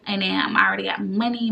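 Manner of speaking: ordinary delivery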